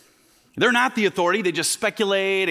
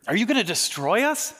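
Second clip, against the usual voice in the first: higher, mock frightened demon; terrified demon; 5 semitones higher than usual. Demon afraid of Jesus, higher mock fear. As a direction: quaver it like a scared demon. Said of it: "expresses terror".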